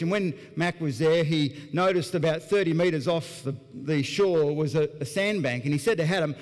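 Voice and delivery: ordinary talk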